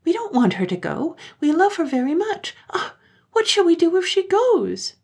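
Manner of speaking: put-on high, loud child voice in despair; high panicked child voice; despairing children. A narrator's reading. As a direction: cry out in panic like little kids.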